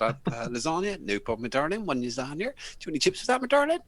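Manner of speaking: Lincolnshire barmaid. Retold or imitated. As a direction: squeal the darling like a chirpy barmaid. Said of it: "I said".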